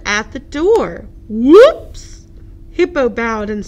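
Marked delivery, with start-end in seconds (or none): 1.3-2.3 loud sudden whoops; startled whoops. Acted. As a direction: blurt it on the bump. Bump at door.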